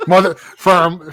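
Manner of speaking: laughing through the words. Real, not acted. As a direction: crack up while talking. Laughing at the slip.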